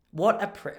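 hint of an appalled female onlooker; disapproving woman. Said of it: "the tone of her voice would be that one".

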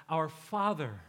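"Father" drawn out and leaned on after "Our"; stress on FATHER. Shown as a demonstration. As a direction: move the weight onto "Father". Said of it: "you emphasize each word".

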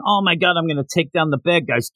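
ordinary delivery

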